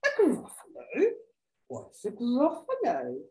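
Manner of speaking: questioning snake, pitch swooping up; puzzled snake. Acted. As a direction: ask in wondering disbelief.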